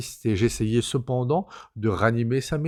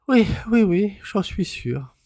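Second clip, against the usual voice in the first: higher, slow, unsure woman's voice; a woman forcing herself to sound certain; hesitant cousine; 9 semitones higher, 4 dB louder than usual. A narrator's reading. Cousine answering the narrator, hesitant and slow. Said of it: "puis elle répondit".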